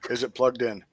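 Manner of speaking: normal talking voice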